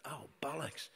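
high, rueful exclamation, caught off guard; a sudden wry curse at bad luck; dismayed groan of a curse. Retelling being shot, he curses in high dismay. Shot, thinks of hospital food.

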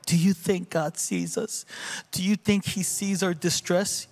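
lifted, questioning voice of a doubting mother; doubtful questioning. Retold or imitated. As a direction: ask it like you doubt anyone is listening.

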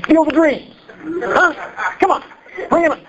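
high-pitched hometown boasting; playful hype. Pretend. Iowan preacher teasing congregation about the Field of Dreams, playful hype. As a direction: hype it up like a proud local.

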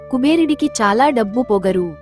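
plain reading voice

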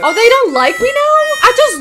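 loud, very high offended outburst; hammed-up high outrage; mock indignation. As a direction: squawk it as if personally rejected. Indignant at being rejected.

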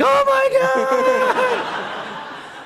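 very high, drawn-out mock scream; hysterical delight. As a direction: scream as if collapsing with hilarity. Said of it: "Jerry hits the deck".

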